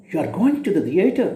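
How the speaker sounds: "You are going to the theater?" quick, rising at the end. Shown as a demonstration. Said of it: "it is a rising tone".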